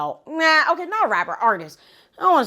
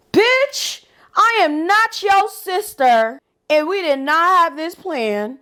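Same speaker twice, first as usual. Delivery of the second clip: high, slow, indignant call-out; high sassy call-out; sassy outrage; 9 semitones higher, slower than usual. Real, not acted. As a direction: put her in her place. Scolding the lying roommate.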